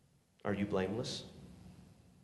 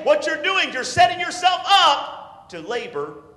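loud, high, driving sermon; preaching at full force; 8 semitones higher, 7 dB louder than usual. Acted. Preacher scolding complaining bus workers, loud and driving. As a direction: press it hard on the crowd.

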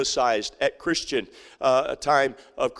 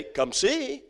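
lifted and gentle; high, light invitation; 8 semitones higher, much slower than usual. Pretend. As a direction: invite them sweetly. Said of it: "to simply say".

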